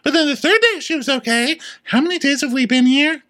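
very high squeaky cast voice; high-pitched mimicry. Recapping Vicky's progress on the trip, high mock voice. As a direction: pitch it way up, chattering on.

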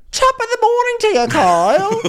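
high, fast stage-Irish greeting; cartoon Irish. Dorit greeting Kyle in a high, fast cartoon Irish accent. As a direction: chirp it like a cartoon leprechaun. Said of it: "cartoon Irish at the moment".

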